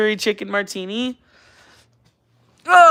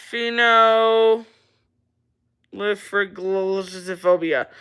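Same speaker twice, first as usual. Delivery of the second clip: slow sounding out; much slower than usual. Shown as a demonstration. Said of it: "Give me the next thing I can't pronounce".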